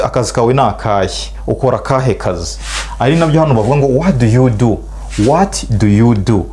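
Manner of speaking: ordinary talk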